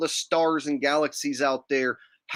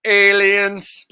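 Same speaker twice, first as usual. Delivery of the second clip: loud, dramatic reveal of aliens; mock announcement; 7 semitones higher, 10 dB louder than usual. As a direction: announce it like a cheesy broadcast. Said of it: "And then be like".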